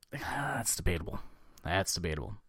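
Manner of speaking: breathy whispered aside after a sigh. Real, not acted. To the co-host, a dry whispered aside.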